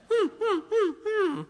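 high, whiny puppy whimpers; imitated puppy whimper. Imitating puppy denied ice cube.

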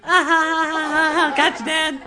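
high, loud cackling little imp; mimicked squealing, gleeful son; impish kid. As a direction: crow it like a prankster child. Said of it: "hear this little impish sound".

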